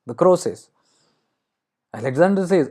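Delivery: usual speaking voice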